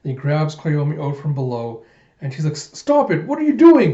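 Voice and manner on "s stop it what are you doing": high, loud stammered yell; acted panic, loud and high; alarmed protest. Koyomi is grabbed by Den, high alarmed protest.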